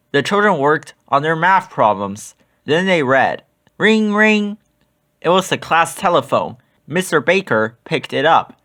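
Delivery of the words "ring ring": higher, sing-song ring ring; phone ringing. Acted. The class telephone ringing.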